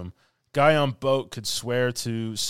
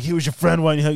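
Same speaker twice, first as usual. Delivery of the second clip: loud, high, accusing demand; raised, pressing imitated challenge; mock interrogation; 7 semitones higher, 9 dB louder, much faster than usual. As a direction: act out an agent grilling a suspect. Retold agent grilling Verbal.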